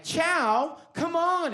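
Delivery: high, loud imitation of a yelling director; loud, high-pitched mock reprimand; exasperated yell. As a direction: scold at the top of the voice. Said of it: "he's, like, yelling at me".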